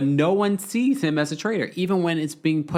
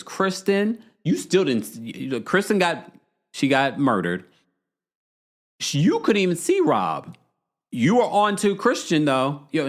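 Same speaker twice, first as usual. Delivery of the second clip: ordinary talk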